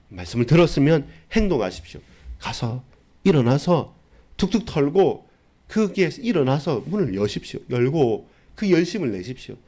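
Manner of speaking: full-force sermon climax; fiery preaching. Acted. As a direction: drive the call to zeal home to the congregation. Exhorting to act.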